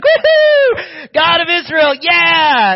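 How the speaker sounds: very high, loud triumphant shout; ecstatic whoop. Retold or imitated. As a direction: whoop it up like a victorious fan. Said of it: "and he's like".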